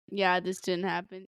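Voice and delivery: mock-confident denial, low and quiet. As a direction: imitate her sure, flat denial. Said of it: "[name] saying it all confidently".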